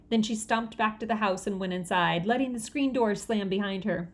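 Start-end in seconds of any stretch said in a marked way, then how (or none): none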